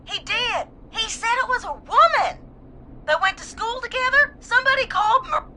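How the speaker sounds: high, urgent, upset revelation; distraught. Acted. Caller starts naming the killer to host, distraught. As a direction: blurt out the clue in distress.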